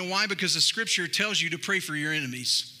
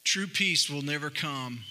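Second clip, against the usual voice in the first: ordinary talk, nothing marked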